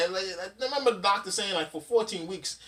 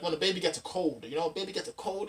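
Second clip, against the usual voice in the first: normal talking voice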